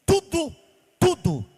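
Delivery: ordinary talk, nothing marked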